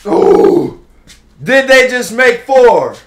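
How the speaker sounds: high, loud astonished yell; amazed shout. Real, not acted. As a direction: gasp in disbelief. Fourth straight home run.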